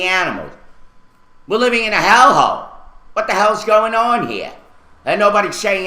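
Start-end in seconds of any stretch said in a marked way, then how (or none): 1.5-4.5 higher-pitched, exasperated outburst; fed-up anger. Real, not acted. Resident ranting about the city's neglect, angry and fed up. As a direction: demand answers, truly fed up. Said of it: "I'm sick and tired of it".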